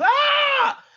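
sudden high, loud wrestler's yell; Yoshihashi yell. Retold or imitated. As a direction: blast it out like a hyped wrestler. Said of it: "looks in the mirror and goes".

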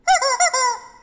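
sharp high squeak imitation; high mock squeak noise; high toy squeak. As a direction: mimic a squeaky dog toy.

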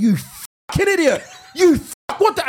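high, drawn-out outraged insult; retold road rage. Driver to someone who's done something stupid, outraged.

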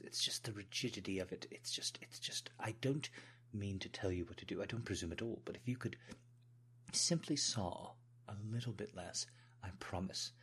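flustered, halting British servant; British servant pleading while being tickled; British accent. Pretend. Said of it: "put on a British accent".

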